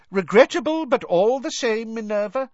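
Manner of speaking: Fudge's loud, high-pitched bluster; loud protest. A narrator's reading. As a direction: raise the voice well up, talking over an objection. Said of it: "Cornelius Fudge was saying loudly".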